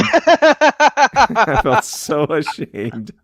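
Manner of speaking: high, very slow and drawn out; hammed-up shame, stretched long; mock shame. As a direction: wail it like it hurts to admit.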